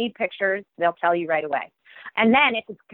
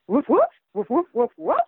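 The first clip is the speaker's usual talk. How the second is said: higher, louder yappy woofs; eager dog barking; 8 semitones higher, 4 dB louder than usual. Acted. Dog pleading with Lady to go to school, higher excited yapping. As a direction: yap them out like an excited pup. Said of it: "says Bow Wow".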